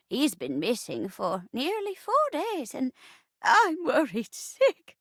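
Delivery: put-on fretful old lady in melodrama; poor old granny. Worried wife asks the detective for news, fretful granny voice. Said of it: "I'll be playing the part of the poor old rocking chair".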